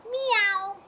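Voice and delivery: high, sleepy little meow; a bored cat's meow; lazy cat meow. Acted. The cat Beatrice answers Mister Goat with a lazy meow.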